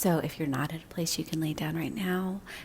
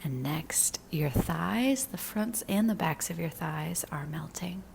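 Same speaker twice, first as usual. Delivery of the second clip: slow, soothing meditation voice with long pauses; unhurried guidance, letting each phrase settle; guided body scan meditation; slower than usual. Real, not acted. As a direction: lead them gently into relaxing, drawing out the phrases.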